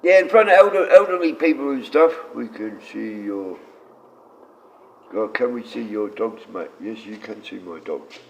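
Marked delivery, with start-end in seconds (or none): none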